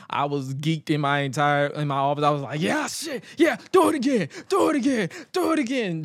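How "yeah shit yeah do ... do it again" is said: high, loud excited yelling; hyped shouting do it again; popping. Retold or imitated. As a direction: cheer it on like a fan in the crowd. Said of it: "I popped".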